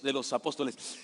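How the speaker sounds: ordinary talk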